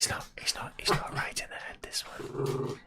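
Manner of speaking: whispered aside; soft confiding whisper. Real, not acted. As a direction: hush it so the dog won't hear. Aside about the dog.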